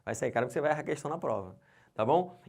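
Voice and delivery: normal talking voice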